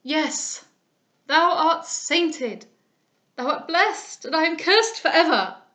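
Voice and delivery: father in desperation over the letter, loud and high; anguished exclaim. A narrator's reading. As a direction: wail it to the heavens. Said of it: "he exclaimed".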